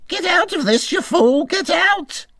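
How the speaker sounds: high, strained panicked rabbit voice; a fleeing rabbit yelling a warning in terror; frightened rabbit's cry. A narrator's reading. Rabbit shouting at the mole to flee, high and panicked. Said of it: "the mole heard him mutter".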